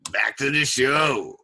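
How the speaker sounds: slow, drawn-out show intro; show-restart announcement. Pretend. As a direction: stretch it out like a host returning from a break.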